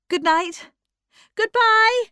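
high, loud farewell call; cried from the step. A narrator's reading. Bertha calling farewell from the top step, loud and high. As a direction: call goodbye down the steps. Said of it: "she cried from the top step".

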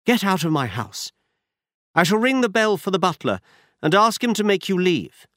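a hint of a frightened noblewoman; Lady Hilda voiced. A narrator's reading.

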